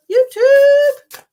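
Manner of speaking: loud, high call of YouTube; a bright hollered YouTube. Real, not acted. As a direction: sing out YouTube to a viewer. Calling out to a viewer watching.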